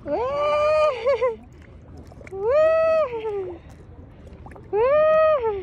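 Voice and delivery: high excited shrieks; excited squeal. Real, not acted. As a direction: squeal with delight at the fish. Excited at fish in pond.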